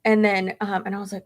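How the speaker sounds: ordinary talk, nothing marked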